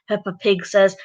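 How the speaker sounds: usual speaking voice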